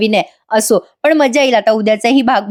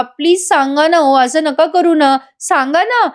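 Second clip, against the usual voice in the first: acted plea in a higher pitch; pleading; 5 semitones higher than usual. Wife begging her husband to tell her, pleading. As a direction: beg with mock urgency, drawing it out.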